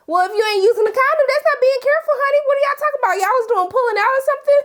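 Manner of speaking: high, fast, loud-ish lecture to the TV; exasperated high "honey" scolding; sassy scolding. Acted. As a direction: scold them like a sassy auntie. Scolding the couple on TV.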